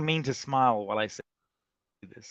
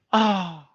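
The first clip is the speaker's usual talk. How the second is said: sharp in-breath gasp, higher; mock gasp; 7 semitones higher, 3 dB louder than usual. Host adding a mock gasp to the cheer. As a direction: gasp as if stunned. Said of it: "he never said the sounds".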